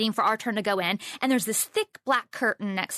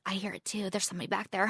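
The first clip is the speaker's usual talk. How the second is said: much softer, low murmur; hushed stranger; 3 semitones lower, 8 dB quieter, faster than usual. Retold or imitated. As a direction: keep it down in the hallway. Stranger speaking low in the hallway.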